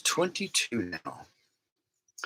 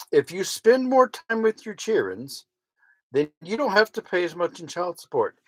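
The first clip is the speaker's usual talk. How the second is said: much higher, sarcastic singsong retort; mocking; 8 semitones higher than usual. Mocking the commenter's accusation in a sarcastic singsong. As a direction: parrot the accusation back mockingly.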